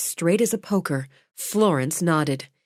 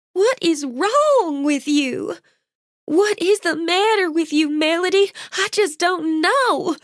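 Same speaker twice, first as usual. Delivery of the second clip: tearful pleading; 10 semitones higher than usual. A narrator's reading. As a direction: plead through tears. Jolene pleads with Melody.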